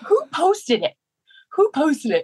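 high, frantic mock panic; a teammate hammering the same question in a high panic; frantic Haley. Teammate retelling Haley's panic over the posted video, high and frantic.

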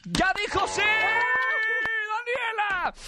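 high, drawn-out game-show holler; game-show gotcha shout. Real, not acted. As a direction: cry out the losing slip, high and long. Presentador canta la derrota del juego. (grito agudo y alargado de concurso)

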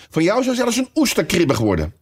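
put-on high, irritable young crab voice; snappish. A narrator's reading. Young crab talking back to its mother, snappish. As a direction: sass the parent in a high voice. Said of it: "zei de jonge krab nogal snauwerig".

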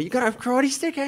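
high Aussie taunt; mock Aki, high and cocky; Aussie accent. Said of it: "he's like".

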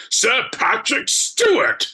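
high, slow, sonorous stage voice; Patrick Stewart. Retold or imitated. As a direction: relish the sound of your own voice. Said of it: "Patrick Stewart, he sounds a little".

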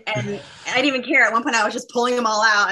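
normal talking voice

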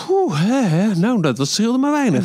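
high, breathy relief; relieved sigh. Real, not acted. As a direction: puff out a close call. Relief after close call.

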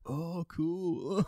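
put-on high breezy young lad; young guy voice. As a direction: play an impressed young bloke. Imitating the young fellas' reaction.

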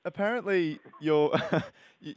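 normal talking voice

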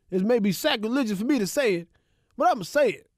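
a full mock Stephen A, building to the punch; Stephen A impression. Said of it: "Is that a good Stephen A?".

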